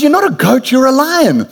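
high-pitched, lightly acted lion's correction; insistent adult lion. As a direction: lightly voice an adult lion setting the cub straight.

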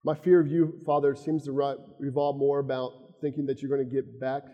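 soft, hushed personal prayer to the Father; quiet prayer. Acted. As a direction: pray humbly, almost to yourself. Leads a closing prayer.

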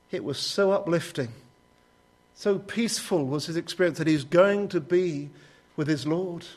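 usual speaking voice

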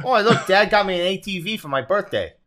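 put-on high, loud happy child; excited kid. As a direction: gush like a spoiled kid. Playing a gleeful kid.

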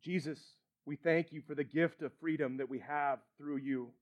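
soft, low, reverent prayer. Real, not acted. Pastor opens a prayer with the congregation, hushed prayer. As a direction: pray quietly and unhurriedly.

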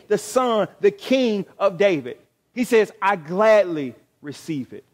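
preacher proclaiming Jesus' answer, loud and rising; fiery preaching. Acted. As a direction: preach it at full force to the congregation. Preaching at full force.

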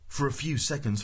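usual speaking voice

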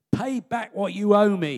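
mock-stern creditor's demand. Servant demanding his debt from a fellow servant, sharp demand. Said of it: "he demanded".

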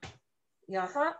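usual speaking voice

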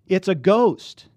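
high, loud, slow scared cry of a ghost; frightened cry. Acted. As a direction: cry it out in terror. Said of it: "they cried out".

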